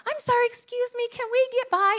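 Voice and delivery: sugar-coat the ask; mock sweet politeness.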